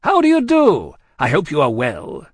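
overbearing, oversized hello; booming greeting. A narrator's reading. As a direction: boom out a hearty how-do-you-do. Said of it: "always seemed too big for any place".

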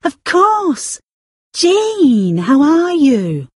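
high, pleased surprise, slowing at the end; surprised recognition. Acted. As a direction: greet an old friend you just placed. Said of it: "she said suddenly".

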